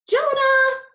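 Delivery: high, loud call across the yard; shrill calling out from the back door; screaming mom. A narrator's reading. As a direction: mom yelling for her son from the door. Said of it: "my mom screamed from the back door".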